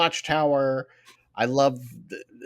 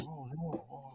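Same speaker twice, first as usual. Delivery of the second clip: nervous; 3 semitones higher, 19 dB quieter than usual. Retold or imitated. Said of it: "a very nervous face".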